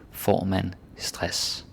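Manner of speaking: slow, even Danish phrase; slow inverted example. Shown as a demonstration. Teacher showing word order, said slowly. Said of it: "or with inversion".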